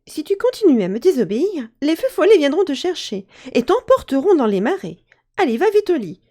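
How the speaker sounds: a mother losing patience at bedtime; cross mother. Acted. Mother scolding her son at bedtime, cross and loud. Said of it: "sa mère finit par se fâcher".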